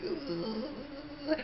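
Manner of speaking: high, breathy shiver sound; mock trembling ugh; shivering gasp. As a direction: shudder out a soft high gasp. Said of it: "I was shivering".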